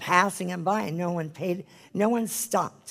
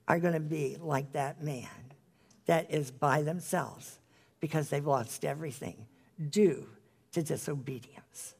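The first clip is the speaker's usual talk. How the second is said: ordinary delivery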